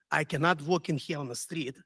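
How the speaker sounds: ordinary delivery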